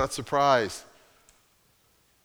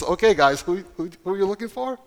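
amused repeat; 7 semitones higher, 5 dB louder, faster than usual. Acted. Jesus asking again, amused.

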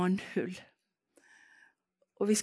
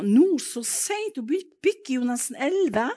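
husband's surprise; 6 semitones higher, 6 dB louder than usual. Retold or imitated. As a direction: pitch it up in disbelief at the hour. Husband objects to late trip.

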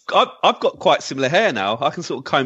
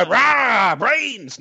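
zombie; 10 semitones higher than usual. Retold or imitated. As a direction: moan it like a hammy zombie. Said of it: "You're supposed to go".

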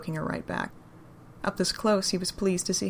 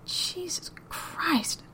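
slow, high, breathless whisper; a stunned whispered oath; breathed whisper; 11 semitones higher, 4 dB quieter, much slower than usual. Acted. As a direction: breathe it out slowly in disbelief. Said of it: "Daryl breathed".

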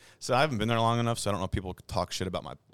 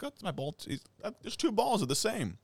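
mock innocent golfer; 14 semitones higher than usual. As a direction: play dumb, sing-song. Golfer caught dropping ball, retold.

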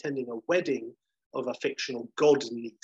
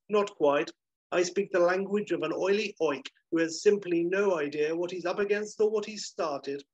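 higher-pitched, lightly acted lofty disdain; haughty retort; 6 semitones higher than usual. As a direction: snub him as a regal gentleman. Regal Bridger snubs Delon.

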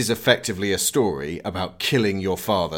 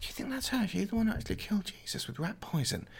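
high, hushed, fast gossip; gossiping whisper; 9 semitones higher, 13 dB quieter, faster than usual. Retold or imitated. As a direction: whisper it behind her back. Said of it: "people see her in the supermarket and go".

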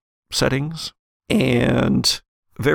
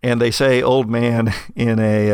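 ordinary talk, nothing marked

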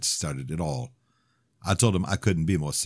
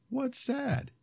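put-on high, soft toddler voice; small curious child; little girl voice; 6 dB quieter than usual.

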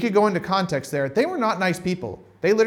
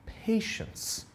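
whispered patience; 5 semitones higher, 9 dB quieter than usual. Shown as a demonstration. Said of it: "that word is".

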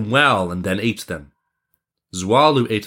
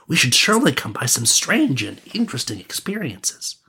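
higher, lilting prince voice; adventurous prince; 4 semitones higher than usual. Acted. Prince persuading his friend to travel, higher and keen.

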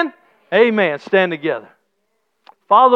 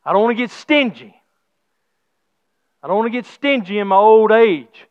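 ordinary talk, nothing marked

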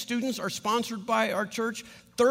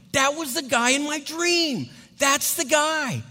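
excited recognition; 6 semitones higher, 4 dB louder, slower than usual. Retold or imitated. Wife recognizes man from dream.

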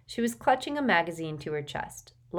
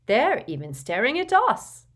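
Neville's voice; 7 semitones higher, 7 dB louder than usual. A narrator's reading. Neville, nervous in the corridor.

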